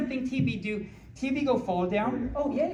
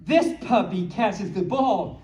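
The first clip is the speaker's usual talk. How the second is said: slow, sing-song picture book read; reading to children; 6 dB louder, slower than usual. Acted. Reading a puppy book to the little audience.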